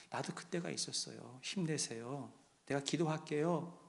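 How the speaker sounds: soft, low, caring words; gentle consoling. Acted. A church member comforting a weary deacon, gentle and soft. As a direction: console softly, keeping the voice down.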